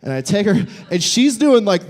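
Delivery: ordinary talk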